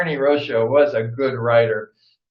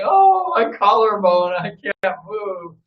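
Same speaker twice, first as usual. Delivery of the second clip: injured friend; 6 semitones higher than usual. Retold or imitated. Ernie lying hurt at the bottom, fading groan. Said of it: "laying down at the bottom going".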